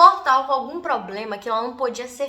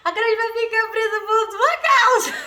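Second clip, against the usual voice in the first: laughing blame; 10 semitones higher, 4 dB louder than usual. Retold or imitated. Sister blaming while laughing.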